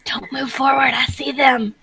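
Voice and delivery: whisper. Acted. Sneaking up on enemies.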